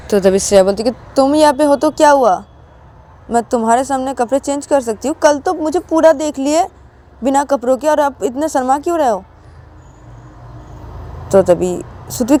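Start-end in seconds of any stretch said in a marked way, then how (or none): 0.9-9.2 high-pitched, insistent questioning; acted teasing protest, pitched up; brazen challenge. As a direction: push back at the shy friend, voice lifted. Challenges the shy friend.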